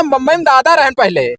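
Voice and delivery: ordinary talk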